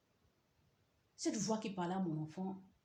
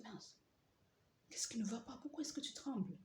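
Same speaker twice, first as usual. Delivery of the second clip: whisper; 5 semitones higher, 6 dB quieter than usual. Retold or imitated. Asking trembling husband at night.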